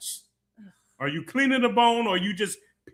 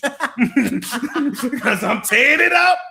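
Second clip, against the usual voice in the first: slow, laughing brag; laughing at her own chicken joke, slow and proud; 6 semitones higher, 7 dB louder, much slower than usual. Real, not acted. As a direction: laugh through it as you draw the words out.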